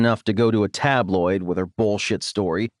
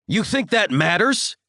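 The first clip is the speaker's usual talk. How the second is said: sharp, high angry snap; snapping; 10 semitones higher than usual. A narrator's reading. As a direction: snap back at him as the father. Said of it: "he snaps".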